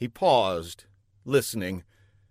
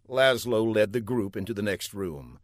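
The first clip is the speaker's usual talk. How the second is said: usual speaking voice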